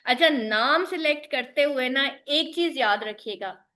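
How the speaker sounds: ordinary talk, nothing marked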